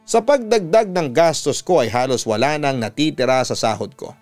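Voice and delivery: plain reading voice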